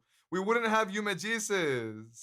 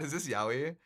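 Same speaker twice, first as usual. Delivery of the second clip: usual speaking voice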